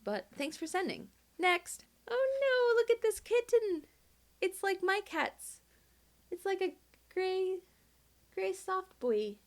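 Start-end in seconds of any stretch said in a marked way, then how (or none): 1.4-9.3 gushing over a kitten. Real, not acted. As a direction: coo like you just saw a kitten.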